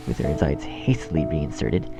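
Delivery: ordinary talk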